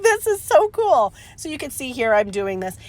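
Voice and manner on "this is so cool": much higher and slowed, delighted; giddy delight. Retold or imitated. Crafter reliving her thrill to viewers, high and delighted. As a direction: squeal it like a kid with a new toy. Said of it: "I was like".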